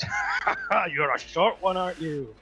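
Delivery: loud, high mocking laugh into a taunt; amused monster scoff; scoffing Minotaur. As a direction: laugh in their face as a big brute. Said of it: "he kinda scoffs and goes".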